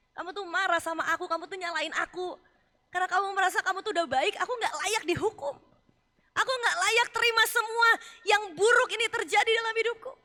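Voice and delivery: from firm to loud, high accusation; building confrontation. Acted. God exposing Job's self-righteous anger, building to loud. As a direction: press the charge harder and harder.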